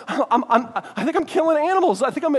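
mock alarm spilling out; anxious confession.

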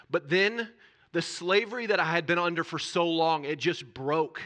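testimony swelling into a sermon climax; impassioned preaching. Acted. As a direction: preach it with rising fervor. Testimony rising to full preaching.

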